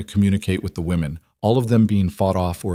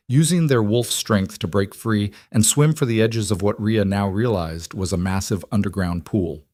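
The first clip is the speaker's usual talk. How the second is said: plain reading voice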